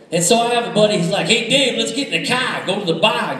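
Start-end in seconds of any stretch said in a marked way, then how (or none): 1.3-2.7 imitating a Boston buddy, R's dropped; mock thick Boston accent. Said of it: "that real thick Boston accent". 2.7-3.4 mock Boston accent, "bar" with its R dropped; thick Boston accent. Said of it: "don't know how to make an R sound".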